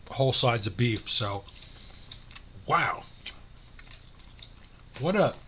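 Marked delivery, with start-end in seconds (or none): 2.7-5.4 chewing while talking; words round a mouthful; mouth full. Real, not acted. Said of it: "I'm sorry I'm chewing".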